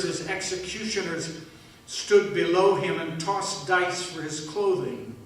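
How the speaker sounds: normal talking voice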